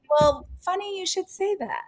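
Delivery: high, put-on teasing voice as the host; playful mock host. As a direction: tease it in a high, sly impression. Imitating host's punchline reply.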